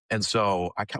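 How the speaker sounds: usual speaking voice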